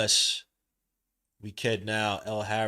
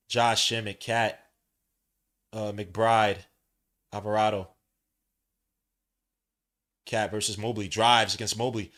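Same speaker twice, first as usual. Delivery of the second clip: play-by-play; 3 semitones lower, 4 dB louder than usual. Acted. Streamer calling the Cavaliers game for chat, play-by-play. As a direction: call each move as it happens.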